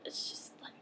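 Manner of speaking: hammed-up speechless whisper; faint, halting mock despair; overwhelmed whisper. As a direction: whisper it as if too dismayed to go on. Overcome, giving up on vegetarians.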